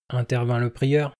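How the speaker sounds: usual speaking voice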